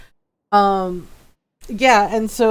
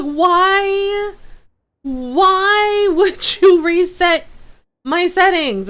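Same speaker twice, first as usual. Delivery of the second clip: high, loud, drawn-out mock anguish; exasperated wail; 9 semitones higher, 4 dB louder, much slower than usual. Streamer asking O B S about reset settings, exasperated wail. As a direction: plead with the machine, stretching WHY. Acted complaint at the software.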